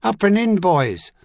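loud, high rallying shout. A narrator's reading. Officer urging his men into the charge, loud high shout. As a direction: drive them forward with a yell. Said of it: "it shouted".

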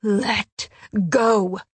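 forceful snarl, each word separate; fierce, punched-out demand. A narrator's reading. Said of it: "She snarled".

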